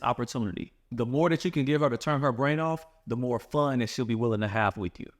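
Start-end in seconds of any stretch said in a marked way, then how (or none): none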